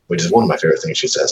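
usual speaking voice

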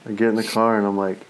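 normal talking voice

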